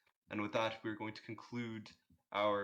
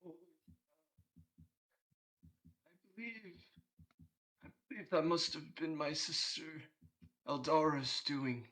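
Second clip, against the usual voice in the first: higher, hesitant Santa voice owning up; lightly voiced sheepish Santa; apologetic Santa; 6 semitones higher than usual. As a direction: fumble an excuse as a sheepish Santa.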